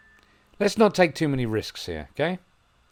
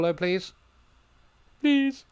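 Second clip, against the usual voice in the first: pleading; 8 semitones higher, slower than usual. Real, not acted. Begging for another goal.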